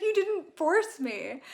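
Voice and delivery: slow, high protest with a lightly put-on voice; Colin protesting. Retelling Colin's reply to Penn, in a slow, high protest. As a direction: insist slowly and high that she did not force him. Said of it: "He's like".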